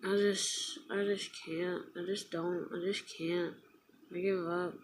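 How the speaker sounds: very soft, real resignation; defeated. Real, not acted. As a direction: give up under your breath, drained. Giving up after the firing story.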